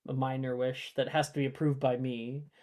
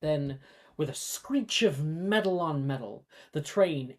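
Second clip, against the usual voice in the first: story narration; 3 semitones lower, 6 dB quieter than usual. Acted. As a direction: set the scene quietly, drawing them in. Game master narrates the train journey.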